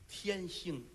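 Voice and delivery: ordinary delivery, nothing marked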